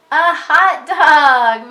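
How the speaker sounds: loud, drawn-out cheer; excited yell. Shown as a demonstration. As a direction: call it out slowly and loudly. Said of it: "So what did Jim yell?".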